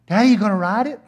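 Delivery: lightly put-on little girl voice; imitated young daughter. Daughter begging dad to ride, imitated little-girl voice. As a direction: ask like a wheedling small child. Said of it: "your daughter looks at you".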